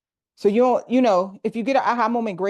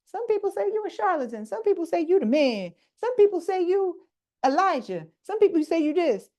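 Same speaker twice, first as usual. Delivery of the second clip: higher, mock-chatty crowd voice rattling off rumours; gossiping disciples; 11 semitones higher than usual. Disciples telling Jesus the rumours, higher mock-chatty voice. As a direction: play the disciples passing on the gossip, pitched up. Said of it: "And then they was all like".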